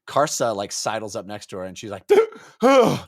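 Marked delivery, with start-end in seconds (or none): 2.3-3.0 mock gasping thanks. Karsa voiced, gasping relief.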